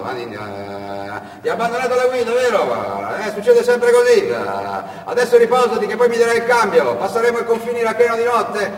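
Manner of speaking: high, loud friendly driver voice; the tourist husband calming the fleeing boy; kindly husband. Acted. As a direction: reassure the runaway boy.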